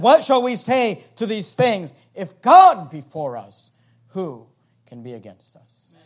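preaching at full force. Acted. Quoting Paul with force.

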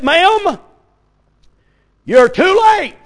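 high, loud, firm preacher's verdict; old-fashioned preacher. Retold or imitated. Old preacher telling the mother she is too late, high firm voice. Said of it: "and he said".

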